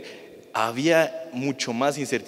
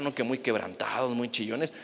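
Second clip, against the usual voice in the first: ordinary talk, nothing marked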